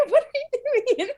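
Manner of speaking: playful alarmed outcry; mock freak-out. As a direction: pretend to freak out through a laugh. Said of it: "everyone freaks out, going".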